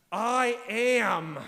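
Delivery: ringing declaration. Retold or imitated. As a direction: proclaim "I am" to the back of the church. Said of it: "has been declared by Jesus".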